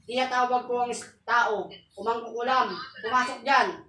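low, soft chanted litany; murmured invocation. Acted. Healer calling on saints in a low murmured chant. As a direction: intone the saints' names quietly.

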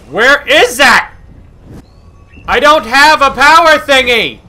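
high, loud exasperated wail; frustrated shout. Real, not acted. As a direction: cry out in exasperation at the game. Cannot find needed game item.

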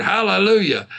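ordinary talk, nothing marked

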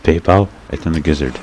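ordinary talk

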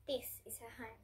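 usual speaking voice

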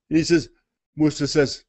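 normal talking voice

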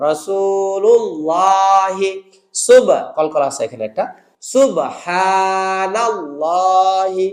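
slow, drawn-out Quranic words with a heavy lam; recitation drill. Shown as a demonstration. Teacher modelling pronunciations for students to repeat. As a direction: recite each word slowly, twice, lam made thick. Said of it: "আমি উচ্চারণগুলো করে দিচ্ছি".

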